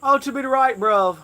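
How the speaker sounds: high, hammed-up British accent; mock British accent. Streamer doing a mock British accent on a friend's dare. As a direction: play a silly British bloke. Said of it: "Slap a funny accent on you".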